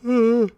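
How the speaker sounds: a big, high, loud yawn; real yawn. Real, not acted. Podcaster yawns out loud while talking to listeners, a big high yawn. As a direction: let a long loud yawn escape mid-sentence. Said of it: "sorry for yawning again".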